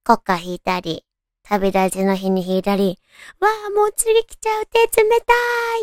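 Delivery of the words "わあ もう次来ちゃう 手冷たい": high, quick flustered cry. Retold or imitated. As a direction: panic playfully about cold hands. Nervous pianist before her turn.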